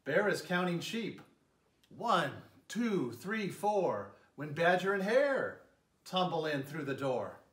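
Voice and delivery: gentle, lilting read-aloud voice; storybook narration. A narrator's reading. Mayor reading a bedtime story to children, lilting narration. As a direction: tell it like a bedtime story for little ones. Reads picture book to children.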